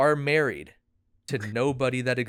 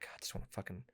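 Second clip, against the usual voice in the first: tired mutter; 6 semitones lower, 10 dB quieter, faster than usual. Retold or imitated. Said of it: "and you're like".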